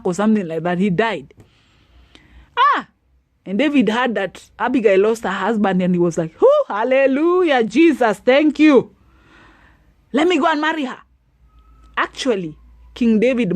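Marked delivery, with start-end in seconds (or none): 2.6-2.9 sharp high "Ah!" of shock; a sudden high cry of surprise; startled exclamation. Acted. Reacting to husband's death. 6.4-11.0 high, louder jubilant thanks; joyful praise. Acted. David rejoices at the news.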